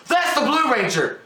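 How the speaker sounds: high mock announcement; mocking loud narrating. Moviegoer mimics mom narrating the movie, mocking high blurt. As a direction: imitate a moviegoer blurting the obvious. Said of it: "She narrated the whole fucking movie".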